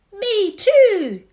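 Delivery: put-on high, loud childish echo; little echoing joey. A narrator's reading. Joey in the pouch echoing its mother, high and cheeky. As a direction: chime in like a cheeky baby. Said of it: "the young kangaroo in her pouch said".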